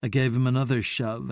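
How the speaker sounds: ordinary delivery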